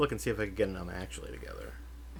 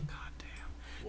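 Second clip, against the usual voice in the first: hushed whisper; 17 dB quieter than usual. Real, not acted. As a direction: whisper it in disbelief. Reacting to the shooting story.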